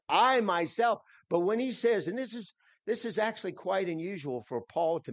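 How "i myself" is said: "I myself" with "I" pitched up and louder, rising; emphasis on I. Shown as a demonstration. Pastor showing the congregation how "I myself" adds weight, stressed. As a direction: punch the "I" to show the emphasis. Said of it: "Myself would add emphasis".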